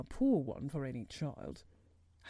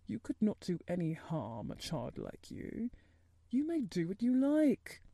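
worried. A narrator's reading. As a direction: reassure the child, with concern in the voice. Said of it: "he said in a worried voice".

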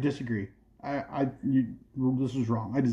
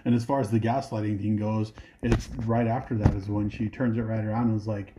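usual speaking voice

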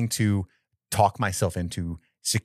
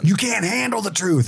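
higher-pitched mock movie outburst; movie line outburst; 9 semitones higher than usual. Quoting a famous movie line to co-hosts, mock outburst. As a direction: belt it like a famous film line. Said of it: "That's where that line came from in the other movie".

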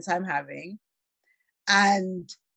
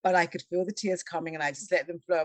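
ordinary delivery

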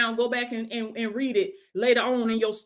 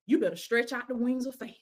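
ordinary talk

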